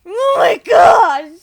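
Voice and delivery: loud, slow exasperated cry; exasperated shout. Pretend. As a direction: drag it out in exasperation. Laughing exasperation at Muffin.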